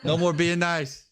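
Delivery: lightly voiced Alex brushing off niceness, slowly; mock Alex. Teasing his co-host by mimicking him, a light mock voice. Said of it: "Alex says".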